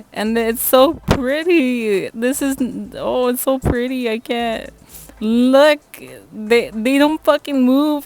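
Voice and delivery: high-pitched, thrilled gushing; gushing excitement. Real, not acted. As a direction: lose it over how pretty it is. Gushing over the swatches.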